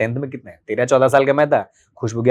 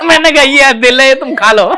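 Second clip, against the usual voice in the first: loud, high, amused quote of his younger self; laughing retelling; 13 semitones higher, 11 dB louder than usual. Retold or imitated. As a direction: belt it out high through the laughter. Retells cheesy mango gift while laughing. (हँसी के बीच ऊँची, तेज़ आवाज़ में)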